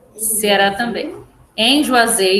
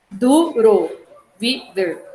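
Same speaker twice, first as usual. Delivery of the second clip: "duro, viver" broken into du, ro, vi, ver; syllable by syllable; much slower than usual. Shown as a demonstration. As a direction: dictate "duro, viver" with a gap between syllables. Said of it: "Vai ser assim".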